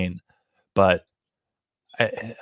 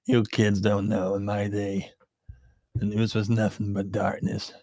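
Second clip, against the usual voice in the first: put-on old-timer voice. Old-timer "in my day" bit.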